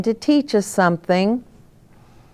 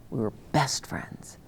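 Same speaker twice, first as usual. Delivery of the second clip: soft fond whisper; 5 semitones lower, 12 dB quieter than usual. Real, not acted. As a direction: whisper it like a cherished secret. Fond memory of her best friend.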